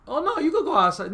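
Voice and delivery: high, light, falsely easygoing voice; mock-breezy parent. Parent letting punished child go outside, breezy and high.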